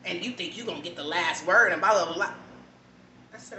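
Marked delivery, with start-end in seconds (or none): 0.0-2.3 mock dismissive. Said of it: "I'm like".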